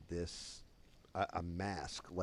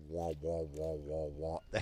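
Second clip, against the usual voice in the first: droning repeated wah-wah; imitated wah-wah noise; 3 semitones lower than usual. Friend passing out on nitrous, imitated wobbling drone. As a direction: pulse the wahs like a head swimming on gas.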